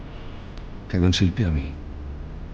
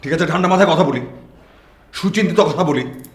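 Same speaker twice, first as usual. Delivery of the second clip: raised, high-pitched demand for calm; heated insistence; 10 semitones higher than usual. Acted. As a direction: insist on reason while still worked up. Still heated, demanding calm talk.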